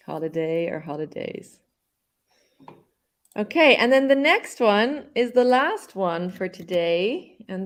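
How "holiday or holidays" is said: soft repeat. Shown as a demonstration. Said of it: "we can say holiday or holidays".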